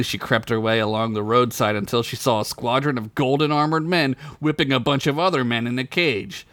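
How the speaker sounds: ordinary delivery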